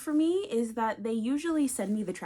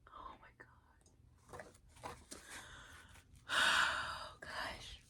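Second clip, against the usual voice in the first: soft stunned whisper; awed whisper; 10 dB quieter, much slower than usual. Real, not acted. Stunned by the book, whispering in awe. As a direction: barely breathe it.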